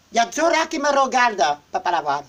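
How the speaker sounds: normal talking voice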